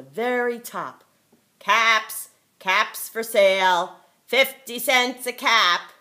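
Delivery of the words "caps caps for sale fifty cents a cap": loud street vendor's call; calling out wares. Acted. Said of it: "he called".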